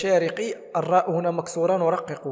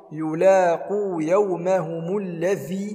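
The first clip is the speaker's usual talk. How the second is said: chanted, stretched tajweed reading; slow recitation; 3 semitones higher, 3 dB louder, much slower than usual. Shown as a demonstration. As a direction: recite slowly, melodically. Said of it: "تبان مخرج حرف الذال".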